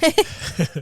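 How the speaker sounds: high-pitched giggle; high laugh. Real, not acted. Laughing at joke.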